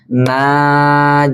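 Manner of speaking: Najaha with the na drawn out; long na. Shown as a demonstration. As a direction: stretch the na in Najaha. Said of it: "huruf na-nya jadi pan-, panjang". (na dalam Najaha dibaca panjang)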